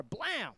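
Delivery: high-pitched, punched "blam"; imitated crash bang. Storyteller imitating being slammed into a car, high bang.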